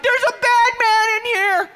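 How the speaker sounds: slow, very high loud wail; hammed-up falsetto terror; panicked sleepwalker. Sleepwalking wife warning her husband, imitated shrieking panic.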